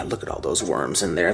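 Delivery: usual speaking voice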